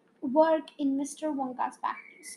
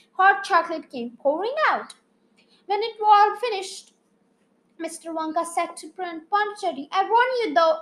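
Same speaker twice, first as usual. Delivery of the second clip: lively storytelling read aloud; animated children's story reading; story narration; 5 dB louder than usual. A narrator's reading. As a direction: read it like a bedtime chapter book. Reads a story chapter aloud.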